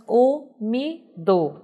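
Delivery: "umido" split into three slow, separate syllables; sílaba por sílaba. Shown as a demonstration. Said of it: "também tem três sílabas". ("umido" silabado, bem devagar, com pausas)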